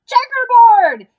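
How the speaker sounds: high, very loud, drawn-out shout; a kid calling it out across the room; excited shout. Acted. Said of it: "Someone else shouted".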